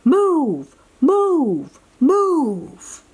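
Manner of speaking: lightly voiced sergeant barking move; a hint of a drill sergeant calling. A narrator's reading. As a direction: snap out orders to the troops. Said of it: "Sarge called".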